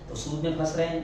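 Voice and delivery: normal talking voice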